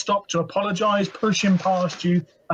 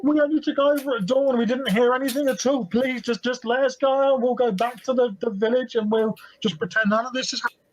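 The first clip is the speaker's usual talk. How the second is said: a lightly voiced scared guard, pleading higher; frightened guard; 5 semitones higher than usual. The guard pleads with the party, scared and higher. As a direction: beg to be let go.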